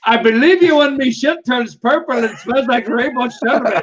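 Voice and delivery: a lightly put-on Irish accent, laughing through it. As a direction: redo the line in Irish, cracking up. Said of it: "my interpretation of an Irish accent".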